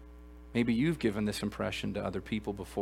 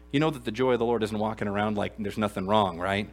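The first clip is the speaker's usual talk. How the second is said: ordinary delivery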